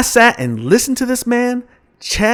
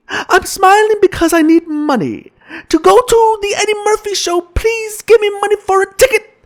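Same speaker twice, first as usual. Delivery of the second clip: put-on high, pleading kid voice; child voice; 8 semitones higher than usual. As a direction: beg like a little boy. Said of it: "And the child says to me".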